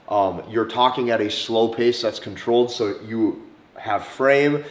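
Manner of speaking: ordinary talk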